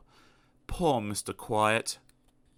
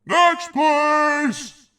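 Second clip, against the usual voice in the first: much higher, very loud call; shouting next; 6 dB louder than usual. Acted. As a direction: dismiss him with a yell. Grocer shouts.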